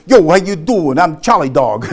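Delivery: loud, high-energy street-style greeting; mock brash self-introduction, punched out loud; brash bull. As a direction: come on strong like a pushy bull salesman. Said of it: "if I'd have been really doing my bulls".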